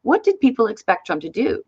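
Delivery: usual speaking voice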